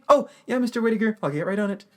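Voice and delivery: high, hurried-to-please character voice; startled reply. Retold or imitated. Dazed Eugene answers Mister Whitaker, imitated startled high voice. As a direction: answer high, as if jolted out of a daze.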